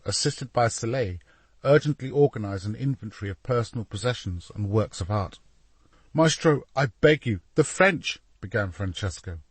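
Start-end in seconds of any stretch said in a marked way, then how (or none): 6.1-8.2 raised-pitch, anxious entreaty; urgent plea. A narrator's reading. Assistant to painter about the French, urgent plea. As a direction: implore the master, a little higher. Assistant begs his master to flee.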